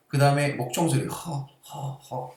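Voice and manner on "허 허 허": 허 breathed softly from the throat; 허 as a soft airy throat sound; breathy 허 demo. Shown as a demonstration. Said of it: "이쪽에서 소리가 나는 거야".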